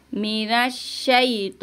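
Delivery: मिनश्शैतो recited slowly with a clean shiin; slow recitation. Shown as a demonstration. Teacher modelling मिनश्शैतो for her students. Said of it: "नून को रॉ से मिलाएंगे".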